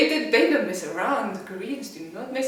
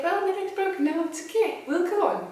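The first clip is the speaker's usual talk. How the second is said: high, airy, unbothered; a man with a broken leg staying calm; breezy nonchalance; 9 semitones higher than usual. Retold or imitated. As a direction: shrug it off cheerfully. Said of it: "I was just like".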